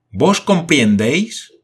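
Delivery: loud, slow, high shout at the Indian. A narrator's reading. Paganel shouting slowly at the Patagonian. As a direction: yell it out slowly so he understands. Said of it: "gritó Paganel con tanta fuerza".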